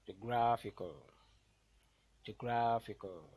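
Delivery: teacher's demo of geo-GRAPH-ical; stressed syllable. Shown as a demonstration. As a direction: hit GRAPH hard in Geographical, falling after it. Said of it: "the one that will be pronounced louder".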